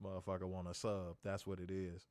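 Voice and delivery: low, quiet mock commentary; hushed teasing. Real, not acted. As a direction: talk about him under your breath.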